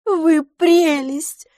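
high, loud, stretched-out praise; delighted cry. A narrator's reading. Miss LaFosse praises her friend, crying out in delight. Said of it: "вскричала мисс Лафос".

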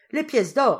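high, loud exclamation; insistent repeat. A narrator's reading. Fisherman demanding the gold from the old mother, loud insistence. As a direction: throw the words back forcefully. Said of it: "répéta Diego".

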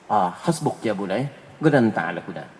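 a low sheikh's verdict. Retold or imitated. Sheikh answers the student.

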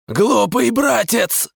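slow, high, strained creature voice; monster voice. Acted. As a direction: drag it out like a spiteful monster. Monster brother sneers at hero.

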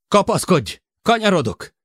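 high, loud warning shout. A narrator's reading. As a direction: yell a sudden warning to your passenger. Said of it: "Eor is kiabált". (izgatott kiabálás)

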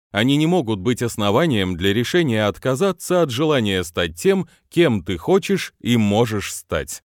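ordinary delivery

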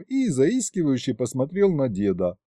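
usual speaking voice

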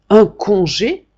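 put-on old marquis leaping up at the request for leave; outraged surprise. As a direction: jump up in disbelief. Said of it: "fit le bonhomme en bondissant".

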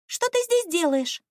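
put-on high-pitched girl's surprise, stress on «здесь»; excited question. A narrator's reading. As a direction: ask it high and eager. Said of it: "Вопрос девчонки задали хором". (оживлённо, высоким голосом)

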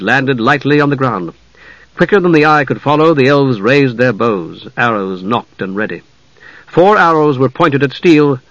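ordinary delivery

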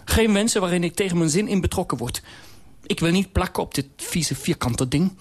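high, fast and offended; protest, pitched high and quick; a peanut butter jar refusing, high and fast; indignant peanut butter. Acted. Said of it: "meldde zich de pindakaas".

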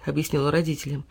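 plain reading voice

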